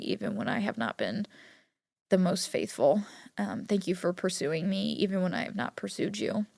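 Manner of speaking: soft spoken prayer. Real, not acted. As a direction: pray softly over them. Prays aloud over listeners.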